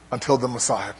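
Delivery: ordinary talk, nothing marked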